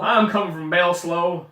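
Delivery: loud, slow, hammed-up Southern drawl; Southern accent. Joking about a town in the South, Southern accent. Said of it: "Sounds like a town in the South".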